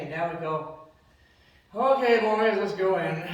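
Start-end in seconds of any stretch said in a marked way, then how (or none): 1.7-3.3 loud, higher resigned call; loud call to the boys. Retold or imitated. Father calls his sons into the house, loudly. Dad reluctantly joining the visit.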